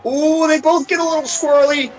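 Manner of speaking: high, thrilled gasp of a call; excited. Real, not acted. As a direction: react to the slide with delight. Cars sliding mid-race.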